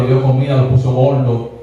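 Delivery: normal talking voice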